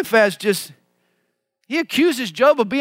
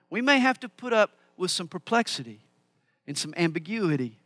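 ordinary talk

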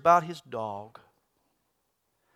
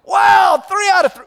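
high, shouted amazement; triumphant cheer; 11 dB louder than usual. Acted. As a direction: whoop it out like a winning owner. Joke: owner celebrates third answer.

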